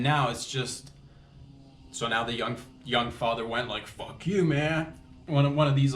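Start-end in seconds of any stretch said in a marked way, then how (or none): 3.9-4.9 higher-pitched retold curse; play-acted angry challenge; mock fuming outburst. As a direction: imitate a dad losing it, without shouting. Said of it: "the papa bear is fuming".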